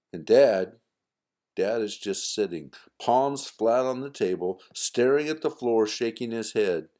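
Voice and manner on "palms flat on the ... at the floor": ordinary delivery, nothing marked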